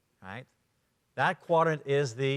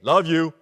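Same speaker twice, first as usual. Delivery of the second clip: "Love you." punched out loud; emphatic love you; 8 dB louder than usual. Shown as a demonstration. Trainer showing how the green style says it, emphatic. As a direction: throw "Love you." out with force. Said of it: "it's how they say it".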